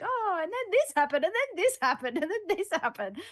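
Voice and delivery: mock exasperation. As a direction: list it like a tired complaint. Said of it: "Instead, I got like".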